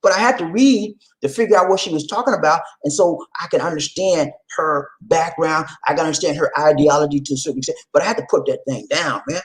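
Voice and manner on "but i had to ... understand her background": normal talking voice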